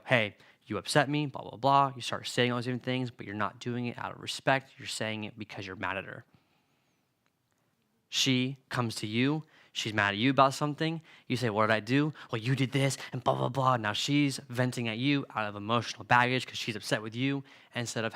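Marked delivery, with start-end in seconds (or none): 0.0-1.9 mock upset complaint. Acted quarrel quote. 11.5-12.1 mock defensive question. Acted reply in quarrel. 12.3-13.8 mock venting. Girlfriend venting quoted.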